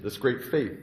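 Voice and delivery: plain reading voice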